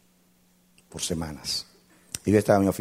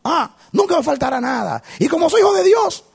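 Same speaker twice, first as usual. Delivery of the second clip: loud, high chanted preaching refrain; full-force preaching; 10 dB louder than usual. Acted. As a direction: belt it out like a revival preacher. (predicación a todo pulmón)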